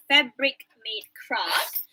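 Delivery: Fabric Made Craft spoken very slowly, every consonant crisp; careful corrected take; clear pronunciation. Shown as a demonstration. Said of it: "you dah betulkan".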